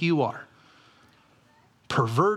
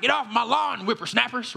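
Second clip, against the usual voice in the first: put-on cranky old man; 7 semitones higher than usual. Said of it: "that guy that when I'm eighty".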